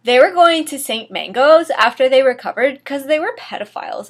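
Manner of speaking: plain reading voice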